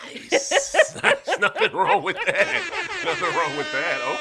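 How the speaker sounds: real laughing, high, loud approval; amused approval. Real, not acted. Host delighted by guest's school-day parties, laughing approval. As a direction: cheer them on through laughter.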